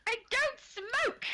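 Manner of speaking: high, drawn-out mock indignation; mock-offended, squeaky protest; indignant denial. Denying advice to stop smoking.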